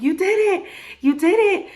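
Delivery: very high, loud squealed cheer; imitated cheering. Family cheering the laboring mother, mock squeal. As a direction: whoop it up like overjoyed family, exaggerated.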